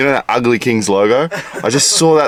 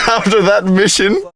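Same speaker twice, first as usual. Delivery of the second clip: high-pitched question out of a laugh; laughing incredulously; 11 semitones higher than usual. Real, not acted. Teasing his mate about the mission, laughing. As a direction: ask it through amused disbelief. Laughing reply to not drinking.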